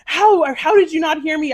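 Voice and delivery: high, loud re-enacted disbelief; incredulous. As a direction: demand an answer, mock-outraged. Acts out disbelief at not being heard.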